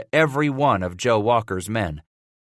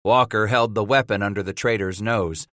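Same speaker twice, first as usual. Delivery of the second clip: usual speaking voice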